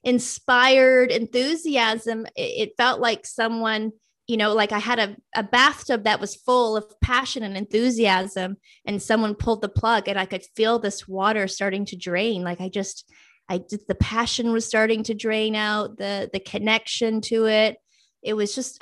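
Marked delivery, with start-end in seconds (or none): none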